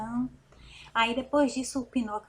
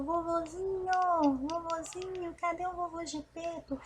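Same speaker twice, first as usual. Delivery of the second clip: calling for grandpa; 6 semitones higher than usual. A narrator's reading. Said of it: "E ele caçou".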